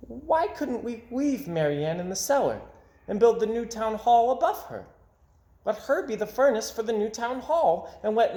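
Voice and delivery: put-on high, eager child voice; little boy's idea. A narrator's reading. Little boy proposing his idea to the town, high and eager. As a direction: pitch the idea like a bright boy. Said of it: "He said".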